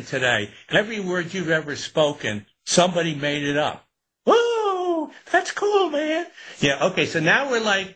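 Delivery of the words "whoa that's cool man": put-on high, wide-eyed surfer kid; amazed kid. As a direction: gasp it like a stunned teen. Voicing amazed student.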